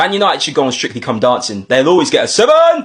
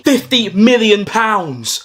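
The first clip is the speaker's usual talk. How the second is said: drawn-out, thrilled big news; slow excited announcement; 5 semitones higher, much slower than usual. Acted. Son telling mum about the win, slow and thrilled. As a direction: savour every word of the win.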